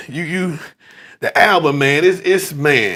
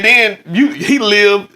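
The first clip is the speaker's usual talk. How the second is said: ordinary delivery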